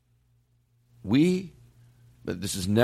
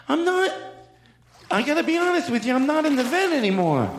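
much higher put-on celebrity voice; Jay Leno; 12 semitones higher than usual. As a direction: squeak it out like the talk-show host. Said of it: "play with some voice things".

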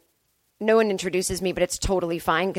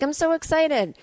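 higher-pitched gushing fan post; mock excitement; 6 semitones higher than usual. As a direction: gush it lightly, like a thrilled ticket buyer. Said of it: "they get all excited".

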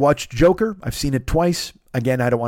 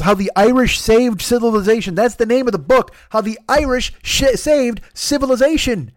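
high excited title; 7 semitones higher than usual. Real, not acted. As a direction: stumble over the title excitedly. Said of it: "That's a hard thing to say".

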